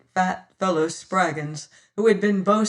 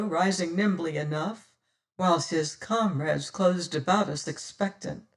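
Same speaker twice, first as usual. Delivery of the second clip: usual speaking voice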